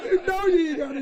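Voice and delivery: high, loud, laughing mock sweet talk to a girl. S0 acts sweet-talking a girl.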